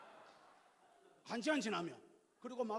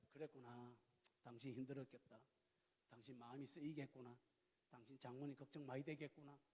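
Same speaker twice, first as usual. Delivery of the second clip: much softer, lower, gentle reassurance; hushed caring husband; tender empathy; 7 semitones lower, 14 dB quieter than usual. Retold or imitated. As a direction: comfort her while listening. Husband's empathetic responses to wife.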